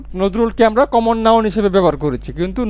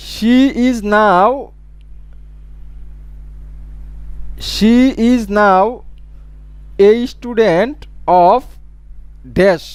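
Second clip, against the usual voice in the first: slow dictation; much slower than usual. Shown as a demonstration. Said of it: "teacher dictates example sentence".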